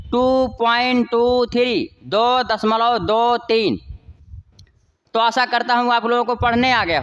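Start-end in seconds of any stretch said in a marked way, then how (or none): 0.1-1.9 slow, "टू" and "three" said as separate digits. Shown as a demonstration. Teacher shows students the right reading, slow and separated. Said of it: "twenty three नहीं पढ़ेंगे". 2.0-3.8 slow "दो दशमलव दो तीन" with separate digits. Shown as a demonstration. Teacher reads the decimal in Hindi for students, slowly. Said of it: "नहीं पढ़ेंगे".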